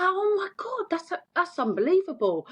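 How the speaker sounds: amazed. Retold or imitated. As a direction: squeal it in disbelief. Friend amazed by the news.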